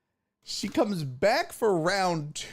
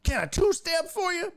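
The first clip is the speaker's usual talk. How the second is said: cowboy imitation; 11 semitones higher than usual. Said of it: "He's like".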